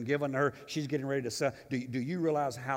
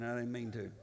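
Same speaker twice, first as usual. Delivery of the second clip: ordinary talk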